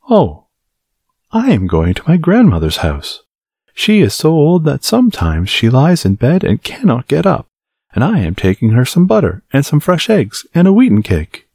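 put-on high, bright little girl; chirpy innocent child. Girl answers the wolf.

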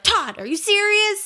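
disbelieving groan at the husband; exasperated. Retold or imitated. Mother to husband Todd as baby cries, high slow exasperation.

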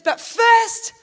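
loud, high objection; urgent interjection. Acted. Follower holding off Jesus with a condition, urgent interjection. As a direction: burst in to stall.

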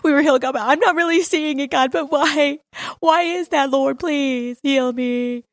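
high, whiny mock begging; doubting, pleading prayer. Shown as a demonstration. Preacher showing how faith doesn't pray, whiny doubtful pleading. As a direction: plead weakly, rising and then dragging out the last words. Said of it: "This is what it doesn't look like".

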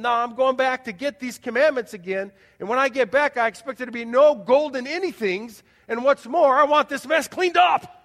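loud, hammed-up shout of an angry lawgiver; cartoon Moses shouting. Preacher imitates a cartoon Moses scolding the people, loud mock shout. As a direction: bellow like a cartoon Moses laying down the law. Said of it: "this cartoon. Moses shouting".

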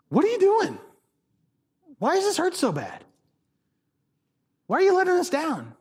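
high-pitched, halting plea; acted pained questioning. Preacher voicing a gut-honest prayer to God, acted pained questioning. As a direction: plead upward, with long pauses between questions.

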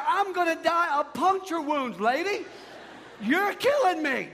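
high, loud comic complaint; mock exasperated protest. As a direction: cry out at the clumsy paramedic, hamming it up. Acted protest at clumsy needle pokes.

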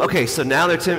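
usual speaking voice

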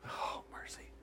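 breathy whispered aside. Real, not acted. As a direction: breathe it out in awe.

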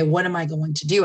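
usual speaking voice

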